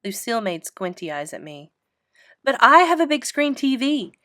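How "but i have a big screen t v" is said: louder, boastful outburst; a girl shouting her trump card at her rivals; real loud. A narrator's reading. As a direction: shout the boast over everyone. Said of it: "she said real loud".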